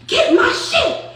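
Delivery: high, loud, drawn-out retold yelling; imitated angry outburst. Noonie losing it at Flo, imitated high yelling. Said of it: "start really losing her shit".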